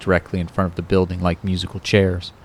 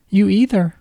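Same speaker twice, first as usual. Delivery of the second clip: much higher girlish voice with a hint of a man underneath; a grown man faking a little girl, pitched way up; babyish high voice; 14 semitones higher than usual. Kyle faking Kate's voice on the phone to Ron, high and babyish. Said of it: "his Cuddly Kate voice holding".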